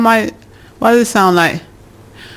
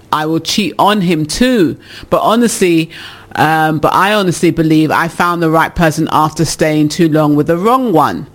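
ordinary talk, nothing marked

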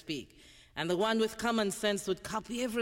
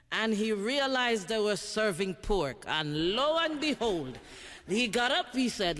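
normal talking voice

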